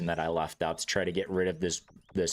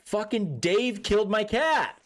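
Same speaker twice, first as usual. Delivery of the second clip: higher, louder, slow accusing blurt; slow, pitched-up angry retelling; retold angry outburst; 12 semitones higher, 4 dB louder than usual. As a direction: fling the blame at the family table. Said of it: "I'm like, I'm like".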